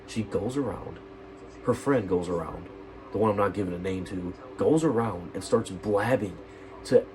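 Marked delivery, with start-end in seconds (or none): none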